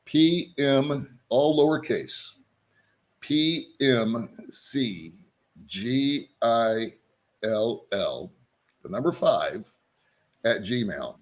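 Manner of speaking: slow dictation, each letter drawn out and separated; real slow. Real, not acted. Vlogger giving viewers his email to write down, real slow. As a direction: spell it out letter by letter, with pauses. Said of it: "I'll say it real slow".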